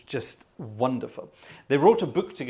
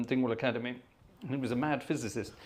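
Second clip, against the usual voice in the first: normal talking voice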